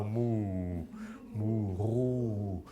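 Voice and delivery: low drawn-out moo sliding into "Ruhe"; cow moo. Shown as a demonstration. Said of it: "Oo, like moo, like a cow".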